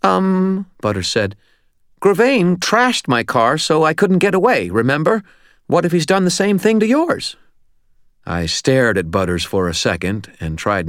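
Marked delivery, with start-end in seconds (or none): 0.0-0.6 high-pitched nervous little guy; high, anxious filler; anxious Butters. A narrator's reading. Narrator voices anxious Butters. 2.0-7.4 high, loud, fretful little guy; high-strung, panicky character voice; anxious Butters. A narrator's reading.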